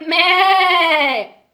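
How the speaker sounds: slow, drawn-out mild bleat; sheep bleating. Acted. Sheep bleating to wake the dog, drawn out. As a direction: stretch the bleat lazily. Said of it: "la oveja hizo".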